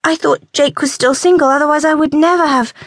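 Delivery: flustered. A narrator's reading. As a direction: blurt it out in embarrassment. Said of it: "she stammered".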